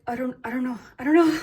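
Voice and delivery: flustered, stumbling, rising to loud; nervous stammer. Retold or imitated. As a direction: babble it out nervously. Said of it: "like, nervous".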